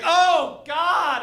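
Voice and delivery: high, loud moan of pleasure; moaning partner. Retold or imitated. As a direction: cry out in pleasure. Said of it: "she's like".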